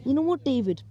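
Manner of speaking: ordinary delivery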